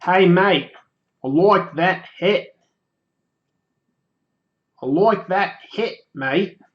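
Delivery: attempted Australian accent. Shown as a demonstration. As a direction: practise a broad Aussie accent, drawing out the words. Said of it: "do an Australian accent".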